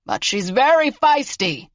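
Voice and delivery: put-on high, slow old-man call; calling from kitchen. A narrator's reading. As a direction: stretch it out to carry to the next room. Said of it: "Marvin yelled from the kitchen".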